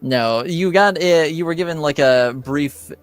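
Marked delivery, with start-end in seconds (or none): none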